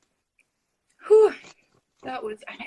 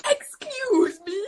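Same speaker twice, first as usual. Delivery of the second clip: high, slow offended gasp; mock offended; 12 semitones higher, 7 dB louder, much slower than usual. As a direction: act hurt and shocked, drawn out.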